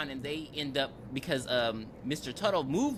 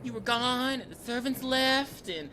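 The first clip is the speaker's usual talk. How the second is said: higher, hammed-up excuse from the wife; higher mock-innocent excuse; 6 semitones higher than usual. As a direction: play the guilty wife brushing it off, pitched up. Wife excusing herself to husband.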